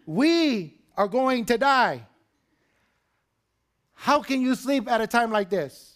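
high, loud desperate plea; frantic, accusing shout, pitched high; panicked disciples. Acted. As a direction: cry out in alarm to wake Jesus. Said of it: "what were they saying?".

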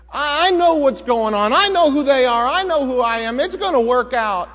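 loud, high, self-assured insistence; Lot's overconfidence. Retold or imitated. Lot reassuring himself among the wicked people, overconfident loud. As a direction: convince yourself you're safe. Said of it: "Lot thought".

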